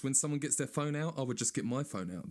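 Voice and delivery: ordinary talk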